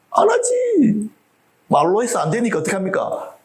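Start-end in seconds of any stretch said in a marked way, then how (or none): 0.1-1.1 high, drawn-out, slow denial; emphatic no. Real, not acted. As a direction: stretch it out like it's obvious. Answering own rhetorical question.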